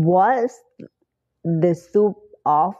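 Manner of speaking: What's the soup of the day in slow chunks, rising on soup; slow drill. Shown as a demonstration. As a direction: chunk it slowly for learners. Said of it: "ก็ตามนี้นะ soup". (พูดช้าๆ ทีละช่วง)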